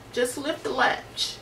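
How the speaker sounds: put-on slow, soft frail old woman; weak old granny calling to the door; frail old grandmother. A narrator's reading. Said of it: "in a frail voice".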